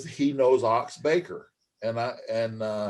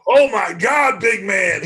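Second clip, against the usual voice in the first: big, loud, high-pitched booming wrestler's voice; booming Ox Baker; 7 semitones higher, 8 dB louder, slower than usual. Retold or imitated. Old wrestler meeting a huge young recruit, booming. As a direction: boom it out like an awestruck old brawler. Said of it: "that big booming voice".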